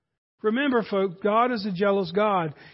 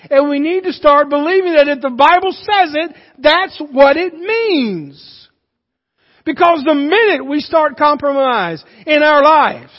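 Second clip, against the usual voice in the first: impassioned, raised sermon voice; forceful preaching; 4 semitones higher, 6 dB louder than usual. Real, not acted. Preacher urging the church to believe, forceful preaching. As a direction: drive each point home to the congregation.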